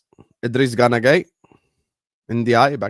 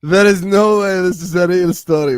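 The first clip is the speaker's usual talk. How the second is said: laughing disbelief; 8 semitones higher, 5 dB louder than usual. Real, not acted.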